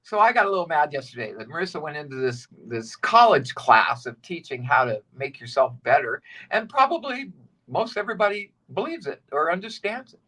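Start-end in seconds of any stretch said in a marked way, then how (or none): none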